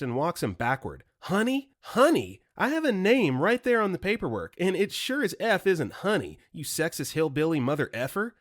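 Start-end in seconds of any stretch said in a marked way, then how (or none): 1.2-1.7 high, rising indignant honey; outraged echo. Retold or imitated. Wife throwing the salesman's word back, outraged and high. 1.8-2.4 higher and louder, falling furious honey; angry retort. Retold or imitated. Wife confronting the salesman, furious falling honey. 2.6-8.3 furious telling-off. Retold or imitated. Wife yelling at sexist salesman.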